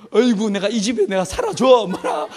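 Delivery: loud, sing-song huffy grumble; grumbling dog voice. Retold or imitated. An irritated pet dog complaining about its home: loud sing-song grumble. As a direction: whine it like an annoyed pet. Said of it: "이러면서 닭들도, 이 개들도요, 짜증을 내기도 합니다".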